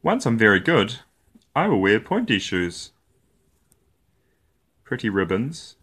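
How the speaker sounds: plain reading voice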